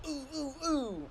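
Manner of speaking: high, drawn-out soft "ooh"s; play-fight noises. Acted. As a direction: make playful, soft mock-hit noises. Mimes playful fake hits.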